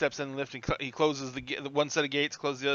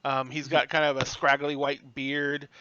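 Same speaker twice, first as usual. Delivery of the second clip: normal talking voice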